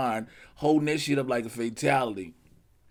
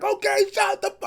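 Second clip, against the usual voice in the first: high squeaky retort; 5 dB louder than usual. Pretend. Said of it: "Godzilla just like".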